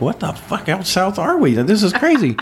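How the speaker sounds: high-pitched retold disbelief; incredulous. Californian driver baffled by the South, incredulous high voice. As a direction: re-enact being utterly thrown, voice climbing. Retelling incredulous reaction while driving.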